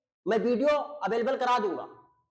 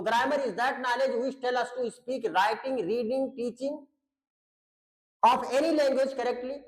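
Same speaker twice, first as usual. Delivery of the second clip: very slow, word by word for notes; धीरे-धीरे लिखवाना; slow dictation; much slower than usual. Shown as a demonstration. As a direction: dictate it so students can write. Dictates the definition slowly.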